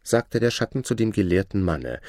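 ordinary delivery

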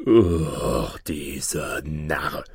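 low, very slow monster voice; deep, drawn-out beast disdain; contemptuous griffin. Acted. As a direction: groan out the scorn from down deep. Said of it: "rief der Vogel Greif".

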